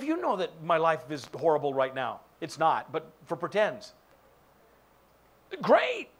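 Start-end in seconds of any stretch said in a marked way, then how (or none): 5.6-6.1 high, loud, forced "Great"; bright fake chirp; hammed-up fake cheer. Acted fake cheer in dialogue.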